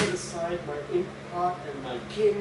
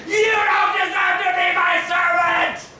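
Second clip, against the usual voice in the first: much higher, loud indignant scream; furious shout; 13 semitones higher, 11 dB louder than usual. Acted. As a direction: scream it right in his face. Face to face, final outburst.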